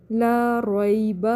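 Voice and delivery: slow لا ریب ending on a brief "ba"; quick final release. Shown as a demonstration. Teacher recites for students, لا ریب with short final vowel. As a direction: chant لا ریب drawn out, dropping the last vowel quickly. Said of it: "با کی زبر کو جلدی چھوڑنا ہے".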